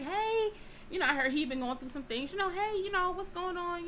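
high, sugary hello; mock sweet greeting. Greeting the man on the phone, high and sweet. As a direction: lilt it up sweetly.

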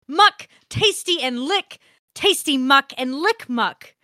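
hammed-up ecstatic read of filthy letter; gleeful reading. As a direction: savour each "muck" like a treat.

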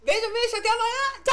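high-pitched, cutesy farewell; playful sign-off. Pretend. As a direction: sing out goodbye way up high. Sign-off in put-on pet voice.

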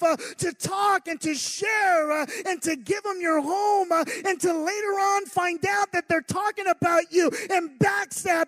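loud, high fervent preacher's shout; preaching at full force. Acted. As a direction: build the congregation up, shouting.

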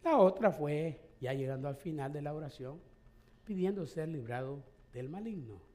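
ordinary talk, nothing marked

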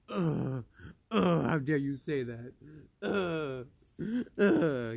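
loud, whiny mock huffing; bitchy upset Fox. Imitating Fox sulking after being told off, loud whiny huffs. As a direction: ham up a petulant tantrum. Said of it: "He was all bitchy and upset".

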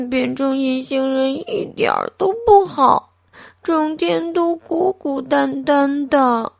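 put-on a lonely boy pouring out his hurt; tearful complaint. A narrator's reading. Invisible boy complaining to himself, tearful. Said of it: "他抱怨道".

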